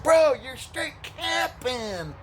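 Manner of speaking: high, drawn-out bro talk; slow, high bro voice. Retold or imitated. Truck guy accusing the teller in a slow, high bro voice. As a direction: stretch it out like a worked-up bro.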